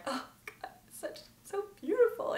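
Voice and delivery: soft, choked-up reenactment, rising high; mock tearing up. Fan reliving a beautiful scene, tearful and soft. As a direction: act overcome, barely getting the words out. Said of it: "starting to tear up at this point".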